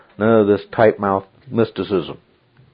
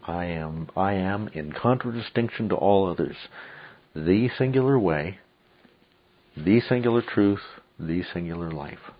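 softer, deliberate weight on each "the singular"; quietly emphatic; 7 dB quieter than usual. Shown as a demonstration. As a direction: hush it and set "singular" apart with pauses. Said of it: "He is emphatic about the way he says it".

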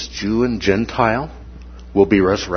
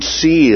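see lifted high and hit hard; one word, see, said high and emphatic; stress on see; 6 semitones higher, 3 dB louder than usual. Shown as a demonstration. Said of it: "is emphatic".

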